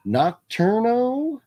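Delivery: hyped call to a viewer; stretched name call. Acted. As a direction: sing the name out long and high. Drawn-out shout-out name.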